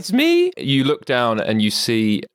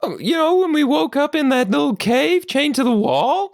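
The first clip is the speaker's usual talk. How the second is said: high, familiar pig character voice; reminiscing little pig. Acted. Pig recalls their first meeting, matey character voice.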